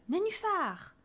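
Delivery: very high croaky toad yell; toad's yell. A narrator's reading. Toad hollers his wish before jumping, high and croaking.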